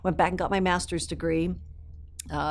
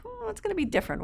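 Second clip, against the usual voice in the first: much higher, bright mock thought voice; mock inner voice; 3 dB quieter, faster than usual. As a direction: play your own younger self's bright idea in a squeaky voice. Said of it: "it's kinda like".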